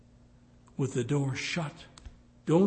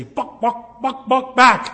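loud, much higher, clucking like a hen; chicken clucking; 10 semitones higher, 14 dB louder, slower than usual. Retold or imitated. Preacher mocking the ad, clucking like a chicken. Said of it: "I want to say".

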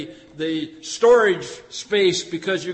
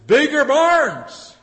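loud, high, gloating planner; a greedy farmer bragging loudly about his barns; boastful rich farmer; 7 semitones higher, 8 dB louder than usual. Acted. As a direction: crow about bigger barns. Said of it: "He said".

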